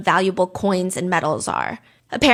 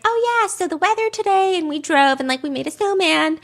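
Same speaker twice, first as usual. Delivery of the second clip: higher, chatty mock mother; imitating his mom; 8 semitones higher than usual. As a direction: play a light, breezy mom on the phone. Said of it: "his mom being like".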